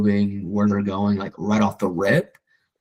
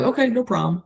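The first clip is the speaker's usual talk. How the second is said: breezy okay; 13 semitones higher than usual. Retold or imitated. Agent's own breezy reply acted.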